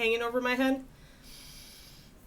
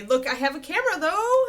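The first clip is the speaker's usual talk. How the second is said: higher, louder pleased announcement; excited; 8 semitones higher, 7 dB louder than usual. Real, not acted. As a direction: show off the camera proudly. Excited about working camera.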